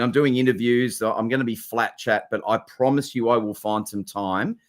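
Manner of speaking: ordinary delivery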